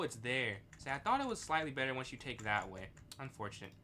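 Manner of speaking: usual speaking voice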